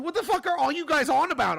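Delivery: high, loud outburst of disbelief; baffled, half-laughing shout at chat; exasperated yell. Real, not acted. Streamer yelling at chat mocking his age, exasperated.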